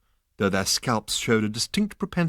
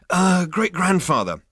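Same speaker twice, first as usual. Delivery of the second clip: nervous; 9 semitones higher, 6 dB louder than usual. Acted. Zaphod interrupts nervously.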